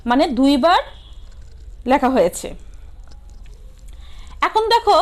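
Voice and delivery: usual speaking voice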